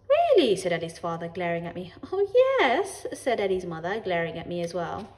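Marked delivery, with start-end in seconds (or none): 0.1-0.6 high, loud, falling "Really?"; feigned surprise. Acted. Parents feign surprise at neighbour. 2.1-3.0 high, loud, put-on eagerness; feigned interest.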